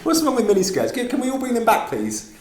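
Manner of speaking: high, loud, leering dad voice; creepy dad voice. Pretend. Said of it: "Said the creepy dad".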